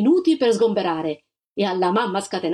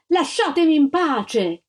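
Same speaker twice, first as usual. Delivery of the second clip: higher, slow, pleading shout; desperate cry; 7 semitones higher, 3 dB louder, slower than usual. A narrator's reading. The mother cries from the window to the army, exasperated. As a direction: beg them to leave her alone.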